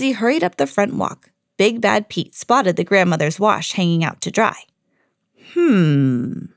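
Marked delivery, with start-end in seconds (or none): 5.5-6.4 low scheming hum; scheming hmm. A narrator's reading. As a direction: hum while hatching a plan. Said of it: "he thought".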